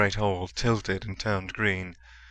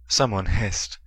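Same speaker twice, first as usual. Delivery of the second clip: ordinary delivery, nothing marked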